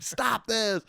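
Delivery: high-pitched, wailed protest; mock an old man freaking out; losing it. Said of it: "this guy's losing his shit".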